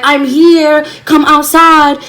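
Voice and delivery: mock scream calling someone out; retold screaming. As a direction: holler up at the house, drawn out. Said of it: "so I started screaming".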